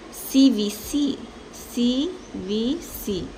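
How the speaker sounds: very slow, soft letter by letter C, V, C; spelled out slowly. Shown as a demonstration. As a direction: space out each letter for learners. Teacher spelling out syllable structure.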